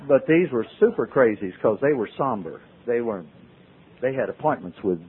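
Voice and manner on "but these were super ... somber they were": ordinary delivery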